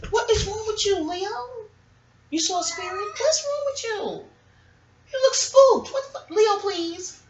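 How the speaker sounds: very high, mostly soft coo; concerned pet talk. Real, not acted. Owner asking spooked Leo what's wrong, high-pitched concern. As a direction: fuss over the pet in a tiny high voice.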